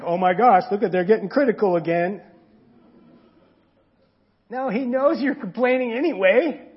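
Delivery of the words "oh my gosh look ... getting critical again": loud, mock-exasperated sigh of a put-upon God. God groaning loudly at his complaining people. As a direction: blurt it out like you're fed up with whining. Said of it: "God's like".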